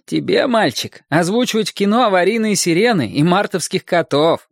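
high-pitched mock scolding; retold teacher's sarcastic put-down; exasperated choir teacher. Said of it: "выгнали с первой же репетиции".

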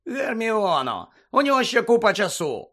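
irritated retort. A narrator's reading. Ron to Hermione about the egg, snappish. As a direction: push back at a nagging friend, exasperated. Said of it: "огризнувся Рон".

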